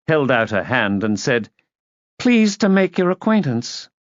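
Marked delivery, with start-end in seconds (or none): 2.2-3.9 put-on high, over-polite greeting; high, clear voice. A narrator's reading. Niece shaking hands with guest, high clear voice. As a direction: pitch it high and proper. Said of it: "high, clear voice that didn't sound at all like her".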